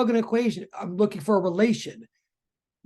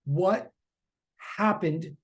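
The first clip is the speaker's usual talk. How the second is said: normal talking voice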